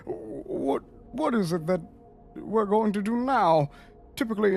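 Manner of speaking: anxious in-character underling; deferential subordinate, worried. Acted. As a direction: raise a concern respectfully to your lord. Plays a subordinate reporting to his lord.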